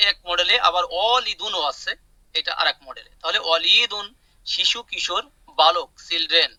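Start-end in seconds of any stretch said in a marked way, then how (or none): none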